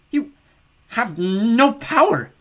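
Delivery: very slow, high drawn-out sneer; icy goddess. Acted. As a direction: stretch each word with cold contempt.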